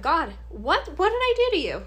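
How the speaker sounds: crying out at heaven in despair; anguished cry to God. Retold or imitated. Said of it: "I started praying".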